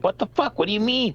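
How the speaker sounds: high-pitched retold indignation; mock disbelief at being told off; indignant. Dad answering a parent who scolded him, indignant.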